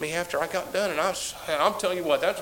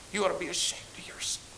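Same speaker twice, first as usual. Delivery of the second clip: self-rebuke; 2 semitones higher, 7 dB quieter, faster than usual. Retold or imitated. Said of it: "and I said".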